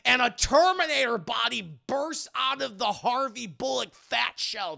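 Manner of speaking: ordinary delivery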